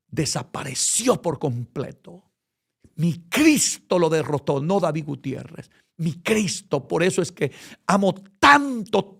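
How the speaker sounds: ordinary talk, nothing marked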